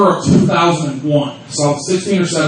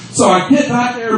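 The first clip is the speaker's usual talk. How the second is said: ordinary talk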